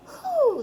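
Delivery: high "Whoo" with an airy h start; owl-like. Shown as a demonstration. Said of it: "almost like you're an owl".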